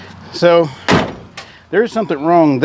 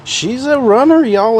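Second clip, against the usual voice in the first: excited; 9 semitones higher than usual. Real, not acted. Engine finally starts.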